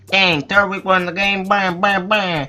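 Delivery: high put-on cartoon creature voice; Trico. Trico concedes Turtwig won, high creature voice. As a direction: hold it high right through the bams. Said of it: "Trico goes".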